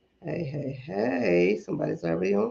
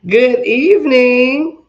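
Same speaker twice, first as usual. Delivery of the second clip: big, slow drawn-out greeting; loud greeting; 6 semitones higher, 13 dB louder, slower than usual. Real, not acted. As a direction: call it out like a host. Livestream host greeting, drawn out.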